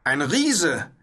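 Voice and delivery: high cry of astonishment; startled high-pitched call. A narrator's reading. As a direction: exclaim it as an amazed knight. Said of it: "rief der Ritter".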